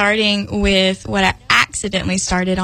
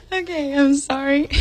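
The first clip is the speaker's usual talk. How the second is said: softer, still laughing; giggly apology; 5 semitones higher, 4 dB quieter than usual. Real, not acted. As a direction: say sorry while still giggling. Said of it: "I don't know why I'm laughing so much".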